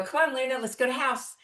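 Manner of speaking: sing-song call to bring the dog in; lightly voiced dog-calling. Calling her dog to come inside.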